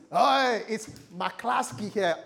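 loud, drawn-out mock announcement; club entrance. Guy entering the club with his name, hammed-up loud hail. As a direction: make a big hammed-up entrance. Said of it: "If that guy entered the club".

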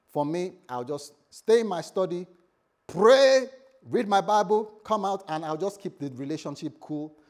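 preacher's voice climbing to a loud peak on pray; mock declaration that climbs to a near shout; rising to a shout.

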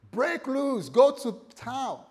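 loud, high egging-on; tempting spirit. Acted. Spirit tempting the lost son, loud rousing voice. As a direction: urge him on with long, booming words. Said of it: "this spirit was whispering to him".

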